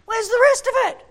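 high, fast, loud demand; exasperated shout. Acted. Preacher wishing the short Gospel went on, exasperated shout.

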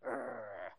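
long, low growl; angry growl. Acted. Angry seamen rounding on Jonah, low growl. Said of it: "growling at him".

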